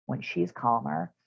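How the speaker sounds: normal talking voice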